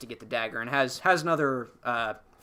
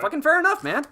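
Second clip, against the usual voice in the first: much higher, louder exclamation; emphatic agreement; 6 dB louder, faster than usual. Real, not acted. Podcaster conceding Hux's motive, an emphatic high exclamation. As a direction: burst out in full agreement.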